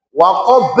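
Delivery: WAQAB cut off with a glottal stop after b; deadened qalqalah. Shown as a demonstration. Teacher shows the mistake, waqab stopped dead. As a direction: kill the echo in waqab abruptly. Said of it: "Contohnya dimatikan hamzah".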